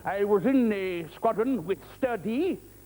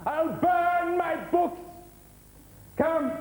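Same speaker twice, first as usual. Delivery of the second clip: booming theatrical Prospero, high and loud; grand declaiming; 12 semitones higher, 3 dB louder, much slower than usual. Acted. Magician bellowing at Caliban from the isle.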